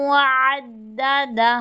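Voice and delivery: slow tajweed chant; Quranic recitation. Shown as a demonstration. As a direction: recite slowly. Recitation demo. (धीमी तिलावत)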